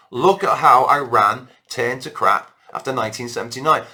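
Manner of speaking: ordinary talk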